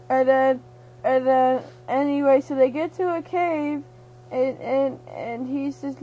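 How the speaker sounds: usual speaking voice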